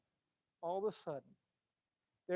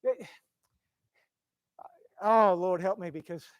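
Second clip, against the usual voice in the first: usual speaking voice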